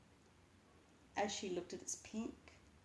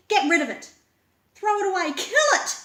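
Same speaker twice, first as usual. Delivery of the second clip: much higher, much louder, frantic; panicked scream; 12 semitones higher, 19 dB louder than usual. A narrator's reading. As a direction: shriek the orders. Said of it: "Master Puppet panicking".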